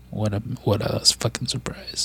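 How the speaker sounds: whispered bitter sarcasm; sarcastic whisper. Real, not acted. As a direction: mutter it under your breath, dripping sarcasm. Sarcastic aside about police inaction.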